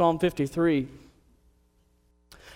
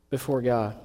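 normal talking voice

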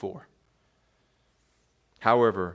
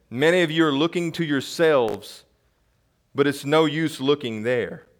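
ordinary talk, nothing marked

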